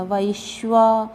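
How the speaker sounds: वैश्वा slowly, with a clear ai diphthong; ai vowel. Shown as a demonstration. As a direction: stretch the "vai" at the start of वैश्वा. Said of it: "वै, ऐ की मात्रा".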